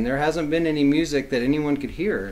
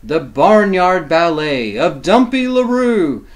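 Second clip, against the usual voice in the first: louder then falling closing line; storybook ending; 6 dB louder, slower than usual. A narrator's reading. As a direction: wrap up the book for the kids. Reading the title close.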